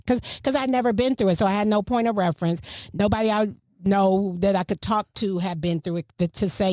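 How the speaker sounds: usual speaking voice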